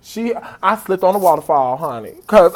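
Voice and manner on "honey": normal talking voice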